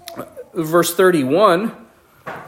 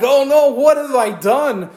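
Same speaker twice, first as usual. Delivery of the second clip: higher alarmed dismay; dismayed trembling; 8 semitones higher than usual. Acted. Isaac realising he blessed the wrong son, higher dismay. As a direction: realise the mistake with dread. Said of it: "And so he's trembling. He's like".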